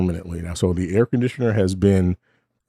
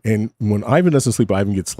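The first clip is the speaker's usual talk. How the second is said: ordinary talk, nothing marked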